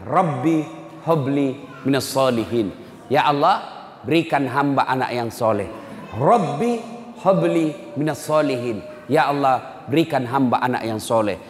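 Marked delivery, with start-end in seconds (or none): 0.0-2.7 dictated slowly so they can memorise; slow prayer recitation for the congregation. Shown as a demonstration. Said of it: "Hafal baik-baik". 6.2-8.8 slow, drawn-out prayer recitation; slow teaching chant of the prayer. Shown as a demonstration. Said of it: "Hafal baik-baik".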